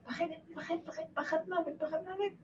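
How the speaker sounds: high, hushed, tiny voice; soft, rising murmur of fear; focusing on fear. Shown as a demonstration. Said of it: "מתמקדת בפחד".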